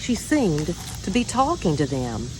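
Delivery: plain reading voice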